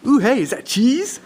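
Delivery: high, rising eager question; suddenly distracted by food; intrigued brother. Hiding brother spotting David's cheese, high eager interest. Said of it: "And his brother says".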